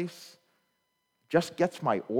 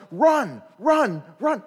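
loud, higher shouts of run; coach yelling; 6 semitones higher, 7 dB louder, slower than usual. Retold or imitated. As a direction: yell it like a coach from the dugout. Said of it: "my coach starts yelling".

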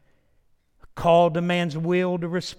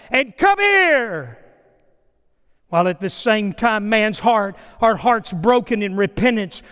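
loud, high, hard-hitting sermon; full-force preaching; 7 semitones higher, 11 dB louder than usual. Real, not acted. Preacher calling people to repentance, shouted at full force. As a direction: thunder the commands out to the congregation.

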